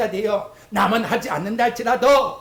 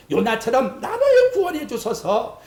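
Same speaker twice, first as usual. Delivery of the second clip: usual speaking voice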